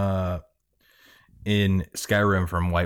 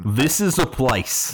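slow hint of an Australian accent on this; teasing Australian-tinged echo; a bit Australian; 6 semitones higher, 3 dB louder, slower than usual. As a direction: mimic the line with a little Australian. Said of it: "you had a little Australian up in there".

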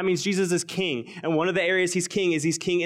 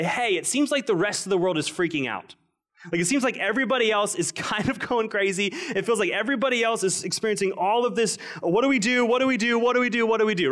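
higher, mock frantic worrier; mock panic; 6 semitones higher than usual. Pastor mimicking anxious world, mock panic. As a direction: play the panicking world, hammed-up. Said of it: "people would look at us and our lives and say".

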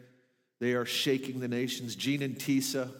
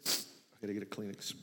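much softer muttered aside, off mic; quiet aside; 6 semitones lower, 10 dB quieter than usual. Real, not acted. Pastor stepping away for a tissue, a soft aside. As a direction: drop to a quick side remark.

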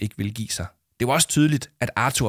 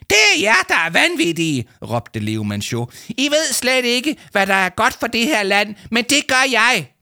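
much higher, louder crazed yelling; manic shout; 12 semitones higher, 5 dB louder than usual. A narrator's reading. Adviser calling everyone else mad, manic shout. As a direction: rant like a madman.

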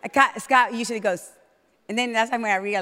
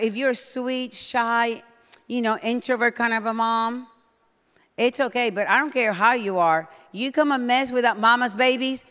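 ordinary talk, nothing marked

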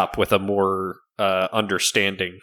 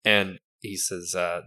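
usual speaking voice

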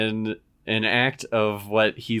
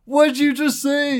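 high, shocked dad voice; a father who can't believe what he heard; mock outraged dad; 4 dB louder than usual.

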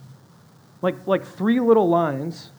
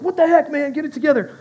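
raised, high-pitched mock self-scolding; yelling at himself; 8 semitones higher, 5 dB louder, faster than usual. Too-intense basketball guy to himself, yelling in frustration. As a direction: chew yourself out like an over-intense player. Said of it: "He's, like, yelling at himself".

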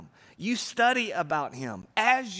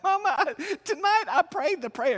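put-on much higher, louder, gushing teenage voice; teen calling mama; 12 semitones higher, 5 dB louder than usual. Camp kid phoning mama, higher and louder with excitement. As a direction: play a teary kid breathlessly announcing news. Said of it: "and they're like".

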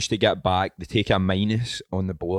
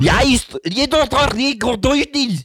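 much higher, much louder, hammed-up Dutch game-show host; Dutch quiz host; 7 dB louder, slower than usual. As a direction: bellow it like an excited Dutch Bradley Walsh. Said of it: "It was just like a Dutch Bradley Walsh".

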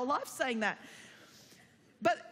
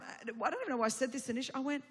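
usual speaking voice

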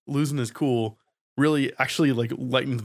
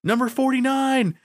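hollering a ticket number across the restaurant; calling out a number; 12 semitones higher than usual. Retold or imitated. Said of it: "I hear her going".

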